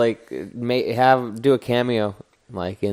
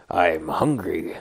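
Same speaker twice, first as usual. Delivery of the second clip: lower, slow Venom growl; low monster voice; 6 semitones lower, 4 dB quieter, slower than usual. Retold or imitated. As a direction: drag it out low and hungry.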